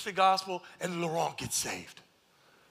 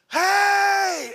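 loud high greeting yell; joyful shout; 12 dB louder than usual. Retold or imitated. Convert returning to church and greeting everyone, joyful shout. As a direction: burst in with a happy shout. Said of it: "he comes in and he goes".

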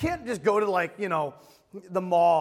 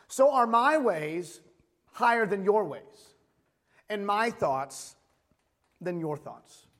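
slow, weighty Bible reading; scripture reading; 4 dB louder, slower than usual. Acted. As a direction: give every phrase room, slowly. Reads Isaiah aloud.

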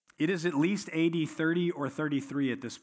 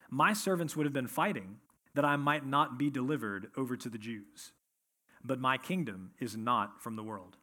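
measured, lower Bible reading voice; scripture reading; 4 semitones lower than usual. Acted. Pastor reading John to his church, measured and lower. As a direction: read the passage to the congregation, steady and low.

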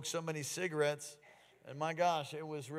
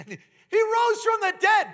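high, loud, slow burst of amazement with a laugh; awed exclaim; 13 dB louder, slower than usual. Retold or imitated. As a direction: land the miracle with wonder. Retelling pastor's miracle testimony.

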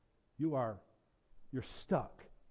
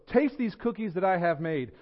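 raised, eager character voice; crying out; 5 semitones higher, 8 dB louder than usual. A narrator's reading. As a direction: call out proudly to a friend, higher and louder. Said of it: "cried Toad".

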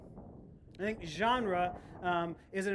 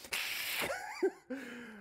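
high-pitched burst of laughter; 14 semitones higher, 5 dB quieter than usual. Real, not acted. Pastor laughs at his goose story, high burst.

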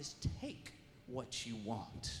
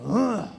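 a sudden loud gruff grunt; loud growling grunt of a tough man; 5 semitones higher, 19 dB louder than usual. Retold or imitated. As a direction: bark out a tough man's grunt.

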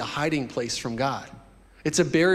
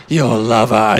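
deep and much louder lover's sign-off; 5 semitones lower, 10 dB louder, slower than usual. Acted. Preacher repeats the sign-off for cheers, loud deep crooner. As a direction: belt it out low, hammed-up crooner. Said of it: "read this in the Boyz two Men voice".

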